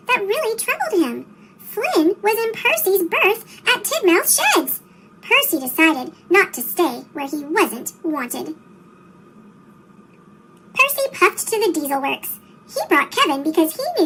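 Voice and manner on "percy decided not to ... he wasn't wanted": usual speaking voice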